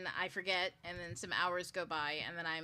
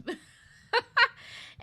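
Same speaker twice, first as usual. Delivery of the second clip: high laugh; 13 dB louder than usual. Real, not acted.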